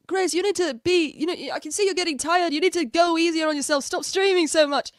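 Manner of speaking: high, loud mock concern; imitated fussing chatter. Streamer mimicking a moody chatter advising her, mock concern. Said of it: "His mood swings are so violent".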